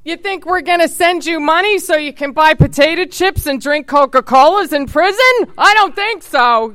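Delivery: a put-on scolding mom, slowing on the last line; imitating her mother. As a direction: play her mom mocking the idea of sending money. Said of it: "my imitation of her".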